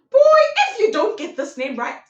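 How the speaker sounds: loud, high-pitched mock scolding; outraged outburst. Storyteller warns the boy about the name, loud mock outrage. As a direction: explode in playful outrage.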